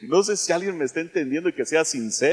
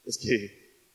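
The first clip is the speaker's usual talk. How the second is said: mumbled excuse; 9 semitones lower, 7 dB quieter than usual. Pretend. Mocking a sinner's lame excuse.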